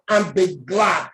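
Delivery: normal talking voice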